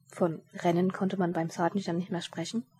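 usual speaking voice

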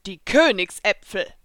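offering the apples with mild enthusiasm; enthusiastic. A narrator's reading. Taurus presenting the royal apples, mildly enthusiastic. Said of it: "sagte Taurus enthusiastisch".

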